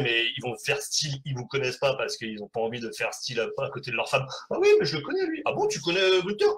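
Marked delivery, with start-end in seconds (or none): 4.5-6.5 wife voice. Acted. Husband and wife acted exchange.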